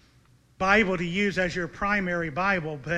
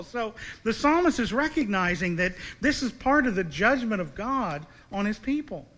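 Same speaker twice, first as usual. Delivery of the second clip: ordinary delivery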